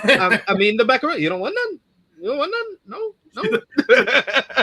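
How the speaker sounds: high put-on voice, much above own; lightly hammed-up film character. Co-host quotes Elena's macaroni scene to Kate Bishop, high put-on voice. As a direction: offer it in a high, playful character voice.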